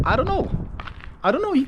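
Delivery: very high squeaked repeats; squeaky silliness. Real, not acted. Vlogger losing it over his English intro, squeaky. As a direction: pitch leaps up into a silly squeak.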